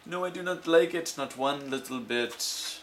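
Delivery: high, fussy little fish voice; a worried fish objecting; fretful fish. Acted. The fish protesting to the cat, high and fussy.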